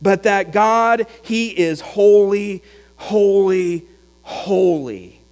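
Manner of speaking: slow, each holy set apart, fading on the last; slow preaching emphasis on each holy; emphatic holy, holy, holy. Acted. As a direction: preach it word by word, letting it settle. Preacher builds to thrice holy.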